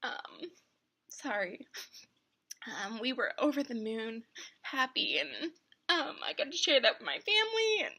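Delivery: crying through the words; real tears, voice breaking; snotty crying. Real, not acted. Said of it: "oh, now I'm ugly crying".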